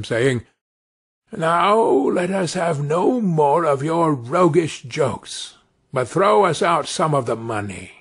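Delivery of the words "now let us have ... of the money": plain reading voice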